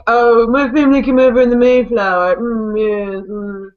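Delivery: put-on affected East Coast old-money snob, trailing off; hammed-up New England twang; old-money accent. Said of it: "like, old money, rich".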